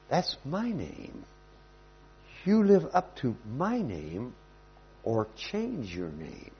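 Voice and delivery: slow, soft, earnest retelling; solemn quote. Acted. Preacher quoting Alexander to the congregation, slow and soft. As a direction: press MY gently and slowly.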